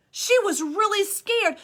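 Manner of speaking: usual speaking voice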